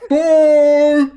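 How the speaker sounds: high, loud muffled shout from another room. Acted. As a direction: call out from behind a door. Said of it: "a muffled voice from the stock room".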